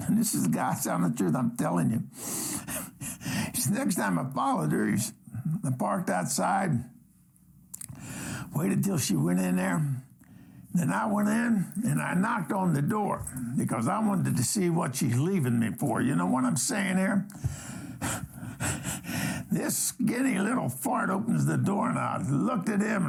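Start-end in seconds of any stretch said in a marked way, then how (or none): 2.1-3.6 soft, breathy chuckling; stifled chuckle. Real, not acted. Host cracking up mid-story about following her, soft chuckle. 17.3-19.4 soft, drawn-out chuckling; breathy chuckle. Real, not acted. Host telling what's behind the door, quiet breathy chuckle. Laughing before the story's reveal.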